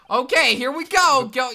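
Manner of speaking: excited. Real, not acted. Excited play.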